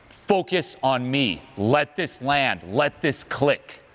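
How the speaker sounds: slow, deliberate and evenly spaced; intent emphasis. Shown as a demonstration. Speaker communicating this point to the audience, slow intent emphasis. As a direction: land each short phrase slowly, like a command. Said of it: "The intention is, I'm communicating this point".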